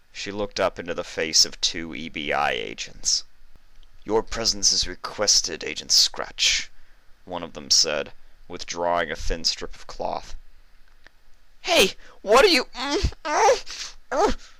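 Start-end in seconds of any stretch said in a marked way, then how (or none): 4.0-6.7 sinister agent voice. Acted. 11.6-14.5 muffled by a gag. Acted. Gagged while protesting.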